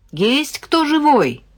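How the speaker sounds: slow, high-pitched call into the house. A narrator's reading. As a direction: call out to see who's home. Calling out into silent house.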